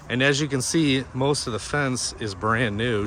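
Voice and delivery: ordinary talk, nothing marked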